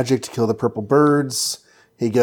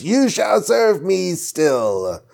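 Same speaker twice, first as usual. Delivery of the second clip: mock villain yell; 6 semitones higher, much slower than usual. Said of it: "while she's yelling".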